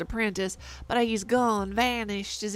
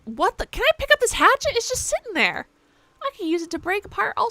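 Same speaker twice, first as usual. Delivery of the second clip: surprised; 10 semitones higher, 4 dB louder, faster than usual. Real, not acted. Surprised at finding hatchet.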